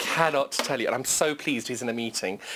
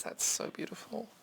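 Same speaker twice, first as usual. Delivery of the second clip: half-whispered in wonder; awed whisper; 6 semitones higher, 12 dB quieter than usual. Real, not acted. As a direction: hush it like a treasure. Awed by the fabric.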